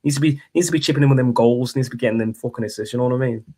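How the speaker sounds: put-on Liverpool accent; grumbling Scouse football pundit. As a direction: do an exasperated fan in a Scouse accent. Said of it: "I can't do a Liverpool accent".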